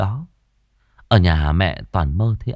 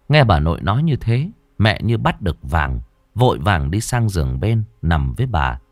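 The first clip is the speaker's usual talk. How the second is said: usual speaking voice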